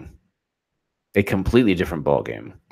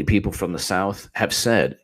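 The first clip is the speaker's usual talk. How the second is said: ordinary talk, nothing marked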